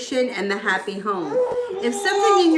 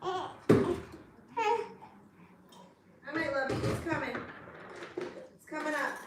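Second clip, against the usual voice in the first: soft murmur; 5 semitones higher, 10 dB quieter than usual. Real, not acted. Quietly feeding the baby.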